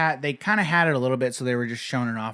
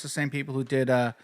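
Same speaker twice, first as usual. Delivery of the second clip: ordinary talk, nothing marked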